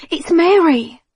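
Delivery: high, loud cry of Mary; shouting the name. Acted. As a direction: call out the name urgently.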